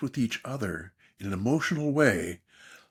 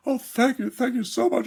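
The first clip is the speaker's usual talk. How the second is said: high imitation of a woman in tears, no real crying; tearful woman; 12 semitones higher, 3 dB louder than usual. Retelling a crying woman thanking him, high mock-tearful. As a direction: voice her gratitude pitched high and a touch tearful. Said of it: "one woman came up to me in tears".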